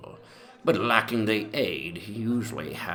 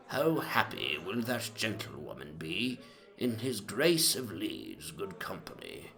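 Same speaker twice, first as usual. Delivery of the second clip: softer, sing-song recital of bad verse; reciting doggerel; 2 semitones higher, 6 dB quieter than usual. A narrator's reading. Narrator recites servant's verse on the Duke's wedding, softly. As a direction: recite the silly wedding rhyme, hushed. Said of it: "I cannot forbear copying them".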